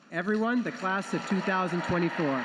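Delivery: loud, projected ceremonial proclamation; big, loud announcer finish; triumphant announcement. Real, not acted. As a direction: present the graduates to a cheering hall. Presenting graduating class to audience.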